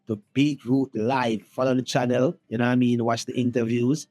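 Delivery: loud shout-out to the viewers; promo drop. Pretend. Guest plugs the show to viewers, loud promo drop. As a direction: punch out a station drop, loudly.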